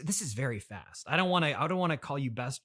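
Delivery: usual speaking voice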